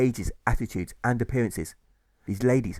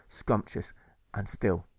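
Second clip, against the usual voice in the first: plain reading voice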